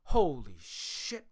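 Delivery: slow, drawn-out awestruck holy shit; stunned reaction. Retold or imitated. Tourist first seeing China, slow stunned awe. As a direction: let each word hang in disbelief. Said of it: "You're gonna be like".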